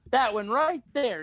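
high, drawn-out retort; slow emphatic comeback, retold; mock defiant answer. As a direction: imitate a woman proudly singling someone out. Woman points out her husband.

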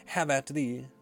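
put-on bold, theatrical boy hero; stagey fake archaic declaiming. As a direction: declaim the challenge with relish. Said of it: "stagey archaic duel challenge".

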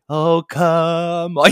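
drawn-out annoyed sigh; slow exasperated groan. Retold or imitated. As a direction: drag it out, fed up.

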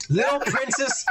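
usual speaking voice

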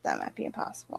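breathy unvoiced aside; whisper. Real, not acted. Friend agrees it is impossible, in a whisper. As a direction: murmur it under your breath.

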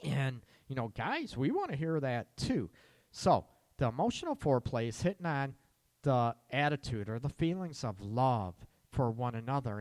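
ordinary talk, nothing marked

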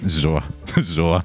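low, understated contempt; contemptuous. Shown as a demonstration. Showing co-host how he'd mutter it at blocking passengers, understated contempt. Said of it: "με υποτίμηση".

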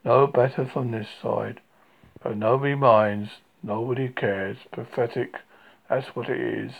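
drooping Eeyore lament; gloomy old donkey. Acted. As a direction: sigh it out like a donkey nobody cares about. Eeyore voice, low and mournful.

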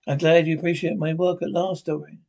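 usual speaking voice

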